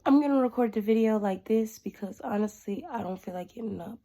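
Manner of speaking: congested, worn out by illness; sick voice. Real, not acted. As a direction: talk like you're really sick. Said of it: "sick as a dog right now".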